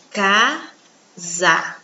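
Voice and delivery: Casa split as Ca-sa, two clear beats; syllables split apart. Shown as a demonstration. Teacher to children, spelling Casa out in two syllables. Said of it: "eu separei em duas sílabas".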